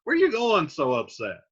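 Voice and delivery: high, startled hold on; catching his nephew mid-storm; surprised uncle. Retold or imitated. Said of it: "he's like".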